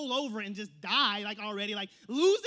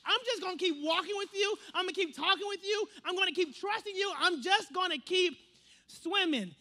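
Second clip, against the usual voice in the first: high, louder, emphatic vow; determined; 6 semitones higher, 5 dB louder than usual. Acted. Ruth vowing to stay with Naomi, determined and emphatic. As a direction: pledge it firmly, stressing each promise. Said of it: "the same type of determination that Ruth was".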